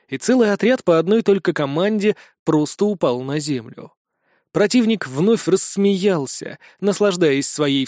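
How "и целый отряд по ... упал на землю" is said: ordinary delivery, nothing marked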